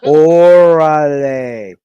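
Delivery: one long, loud, stretched-out cry; playful drawn-out holler. Pretend. Answering a co-host's mock Spanish, long playful holler. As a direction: holler it and hold the vowel. Joking Spanish exclamation among hosts.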